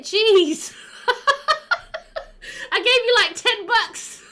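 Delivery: high, loud giggly disbelief; laughing. Real, not acted. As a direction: laugh it out, touched and amused. Laughing at generous gift.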